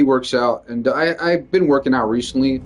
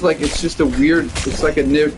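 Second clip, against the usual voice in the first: ordinary talk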